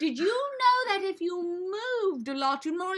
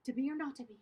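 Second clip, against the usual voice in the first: quiet repetition; 8 dB quieter, much faster than usual. Shown as a demonstration. Quieter take in repetition drill.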